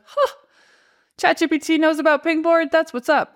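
delighted surprise. Real, not acted. Host finding ChatGPT recommends Pingboard, delighted surprise. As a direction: sound happily caught off guard.

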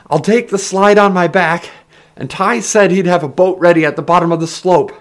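high, loud, projected voice; shouted explanation. A narrator's reading. Ben explains the boat to Heron, shouting. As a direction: yell the plan over rushing air. Ben yelling plans while falling.

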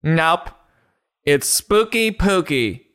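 mock child's shout. Pookie corrects Mom about the ghost, mock shouting. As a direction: call it out slowly in a pretend kiddie voice. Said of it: "And Pookie shouting".

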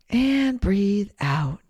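slow, drawn-out exhale cue; slow drawn-out breath cue. Shown as a demonstration. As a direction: stretch the words out like a long breath. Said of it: "make the S sound when you breathe out".

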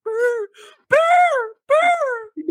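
very high, squeaky mock coach yelling from the bench; imitating coach Mark Crawford. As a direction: ham up the coach barking at a player, way up high. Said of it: "He sounded like a moron".

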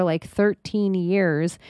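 usual speaking voice

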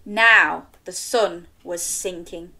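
ordinary delivery, nothing marked

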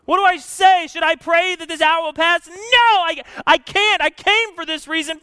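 loud, high, pleading prayer to the Father; impassioned cry. Acted. Preacher crying out as Jesus before the cross.

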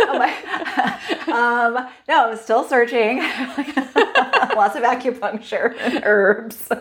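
high laughing answer. Real, not acted. Asked about turning fifty, she answers laughing. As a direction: laugh through the words, caught off guard.

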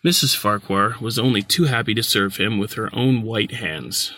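plain reading voice

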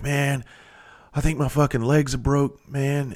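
a hint of a hurt man in pain; imitating a scared deputy. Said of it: "he was upset, man".

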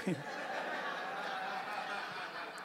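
soft, breathy laugh at his own joke; real laugh. Real, not acted. Pastor laughing at his own devil-binding joke, soft real laugh. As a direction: let a quiet laugh slip out.

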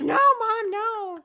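put-on high, loud, slow whiny teen protest; son's protest. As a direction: drag out the protest like an exasperated son. Son Jacob's reply voiced by mom.

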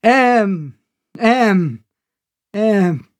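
high, falling repeats of a shout; echo. A narrator's reading. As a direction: imitate the echo of your cry. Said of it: "Again, the echo".

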